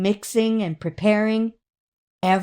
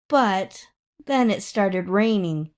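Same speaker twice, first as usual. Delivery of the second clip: usual speaking voice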